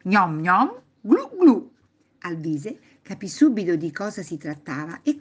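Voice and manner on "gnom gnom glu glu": high, loud gobble and glug; sly munching noises. Acted. Grandpa slyly offering a snack, munch-and-glug noises. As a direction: playfully mimic eating, then gulping. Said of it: "aggiunse con aria furbetta".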